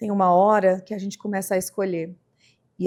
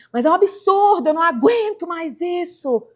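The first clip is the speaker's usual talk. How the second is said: higher and louder, at the end of her rope; exasperated outburst; 8 semitones higher, 6 dB louder than usual. Retold or imitated. Exhausted wife shouting during a fight with her husband, at the end of her rope. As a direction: cry out that you can't take it anymore.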